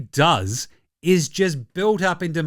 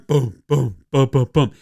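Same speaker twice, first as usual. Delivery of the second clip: low rhythmic boom chant; chant beat; 5 semitones lower than usual. Retold or imitated. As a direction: thump out the crowd beat. Imitating the arena chant beat.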